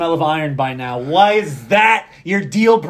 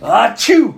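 sneezing; 5 semitones higher, 6 dB louder than usual. Real, not acted. Sneeze mid conversation, "Oh shit.

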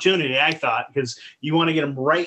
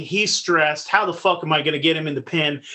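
ordinary delivery

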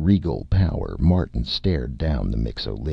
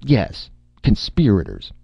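higher-pitched, nervous insistence; 6 semitones higher, 3 dB louder than usual. A narrator's reading. Martin accusing the others, nervously. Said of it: "he went on nervously".

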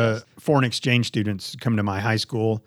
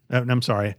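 ordinary delivery

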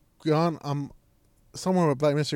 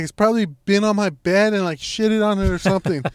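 raised, louder imitation of outrage; disgusted mock rant; 4 semitones higher, 6 dB louder than usual. Sister fearing rat was on her bed, mock outraged. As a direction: rant it out, hammering the key words.

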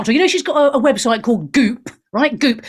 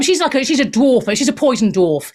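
usual speaking voice

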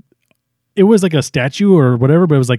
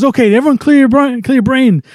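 raised, much higher-pitched instruction; rally everyone urgently; team leader urging; 10 semitones higher than usual. Retold or imitated. One hero telling the team to think of nothing, urgent. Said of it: "He goes".

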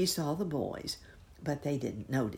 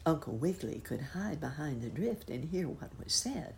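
usual speaking voice

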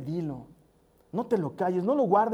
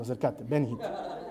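mock stern father; 9 semitones lower, 5 dB quieter than usual. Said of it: "le digo con cara de enojado".

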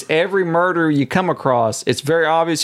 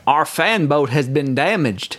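slow mock Georgian drawl; genteel Southern Georgian put-on; Georgian accent; 3 semitones higher than usual. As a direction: announce it in a hammed-up Georgian accent. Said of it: "the best Georgian accent I can do".

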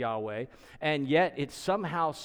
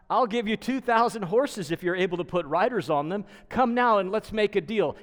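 loud, higher, taunting offer; mocking offer; 6 semitones higher, 6 dB louder than usual. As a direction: make fun of them with a bold bargain. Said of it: "He's actually making fun of them".

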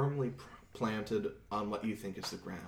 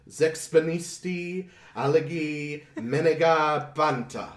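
slow, loud magical chant; spell incantation; 5 semitones higher, 8 dB louder than usual. Acted. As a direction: cast the spell with force. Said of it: "and says".

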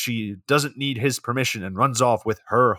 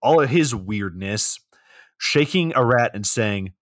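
ordinary talk

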